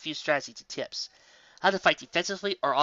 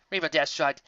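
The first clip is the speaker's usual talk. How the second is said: ordinary delivery, nothing marked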